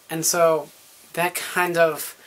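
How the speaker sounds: usual speaking voice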